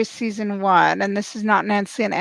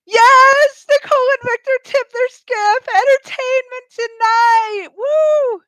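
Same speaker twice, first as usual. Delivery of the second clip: much higher and louder whoop of triumph; joyous shriek at the TV; ecstatic shout; 13 semitones higher, 5 dB louder than usual. Real, not acted. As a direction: scream with delight as they fall in. A team finally tips over.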